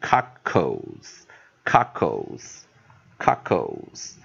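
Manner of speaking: CUCKLES very slowly, split as cuck-ols; slow pronunciation demo. Shown as a demonstration. Teacher modelling the L E ending, slow pronunciation demo. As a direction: spell out CUCKLES by ear, ending in "ol", long pauses between repeats. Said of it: "所以我们就念".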